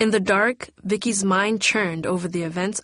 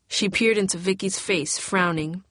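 ordinary delivery, nothing marked

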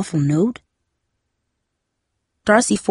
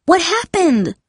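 high, shocked question; alarmed cry; 11 semitones higher, slower than usual. A narrator's reading. Darcy sees her sister's swollen eye, high alarmed cry. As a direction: pitch leaps up in alarm. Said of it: "Darcy cried, jumping up".

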